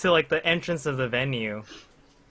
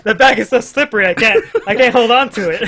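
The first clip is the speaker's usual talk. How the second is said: laughing while talking; 9 semitones higher, 11 dB louder than usual. Real, not acted. Laughing while recalling the bag.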